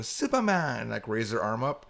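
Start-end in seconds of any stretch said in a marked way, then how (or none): 0.0-0.8 light high call of Superman; imitating the maids calling out to him; mock cheer. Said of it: "they would just point to me and go".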